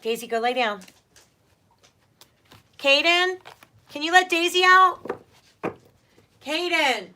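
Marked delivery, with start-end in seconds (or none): 2.8-7.1 loud, high call across the house; real yell to the other room; yelling to son. Real, not acted. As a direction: shout for him from another room. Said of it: "Sorry, I don't mean to yell".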